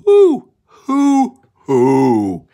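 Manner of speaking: loud, high hooting owl call. Pretend. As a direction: hoot like an owl for the kids. Owl call in animal book.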